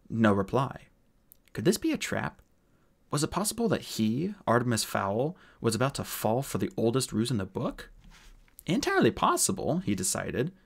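usual speaking voice

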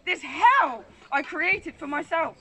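measured spoken-word poem with deliberate pauses; poetry recitation. Acted. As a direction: perform it line by line, swelling on key phrases. Recites own poem on stage.

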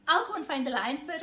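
put-on child hurrying off, scared of the lion; hurried nervous excuse. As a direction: rush away from the lion.

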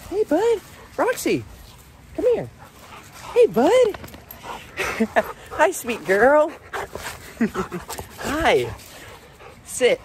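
doggy talk. Real, not acted. Greeting the excited family dog.

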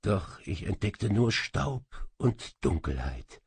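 ordinary delivery, nothing marked

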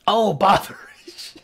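slow, drawn-out mock dismay; a lightly voiced fussy character; mock character exclamation. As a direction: stretch it like a fussy old character. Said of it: "Oh, botheration!".

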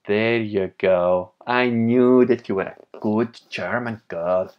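a slow, loud hammed-up German voice; mock German accent. Mock German after viewer says German.

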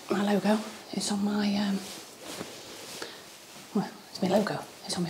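softly whispered, half to herself; whispered aside. Real, not acted. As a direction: whisper it to the camera. Soft aside about her logo.

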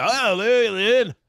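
loud, high garbled Scottish hello; Scottish accent. Acted. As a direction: greet in incomprehensible Scottish. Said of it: "the worst is the Scottish".

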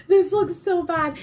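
can't stop giggling; giggly. Real, not acted. Still laughing at the painting.

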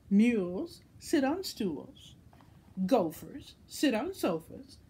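know-it-all cat, sing-song list that climbs high on the last line; a hint of a smug cat, lightly voiced; bossy cat. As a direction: lay out the rules like it's obvious. Cat explains its rhyming rule.

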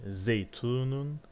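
Zeytunun said very slowly, syllable by syllable; slow Arabic demo. Shown as a demonstration. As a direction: model Zeytunun slowly for the students. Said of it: "El kitabı olmuş".

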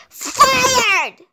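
high furious exclamation; indignant shout. A narrator's reading. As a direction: fire them outright. Hen fires them.

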